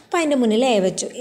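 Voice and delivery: ordinary delivery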